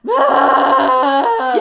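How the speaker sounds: very loud screaming cry; goat scream. Retold or imitated. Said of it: "they're like".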